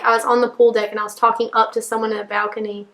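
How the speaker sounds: ordinary delivery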